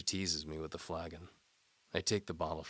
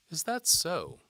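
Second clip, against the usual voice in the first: higher, louder teasing lady voice; seductive noblewoman; 13 semitones higher, 9 dB louder, slower than usual. A narrator's reading. Antimony dares the knight to follow. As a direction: tease the knight like a bold noblewoman.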